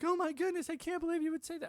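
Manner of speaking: high, prissy mock outrage; mock-horrified high falsetto quote; mock shocked pearl-clutcher. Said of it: "And everyone's like".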